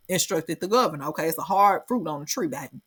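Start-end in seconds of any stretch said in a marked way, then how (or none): none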